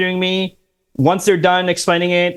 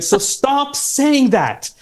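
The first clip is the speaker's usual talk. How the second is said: mock scolding; 9 semitones higher, much slower than usual. Real, not acted. Scolding listeners after joke.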